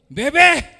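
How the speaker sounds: loud high call. Retold or imitated. Neighbor loudly calls out to her spouse, sweet shout. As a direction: shout a pet name across the house. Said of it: "Minsan sisigaw".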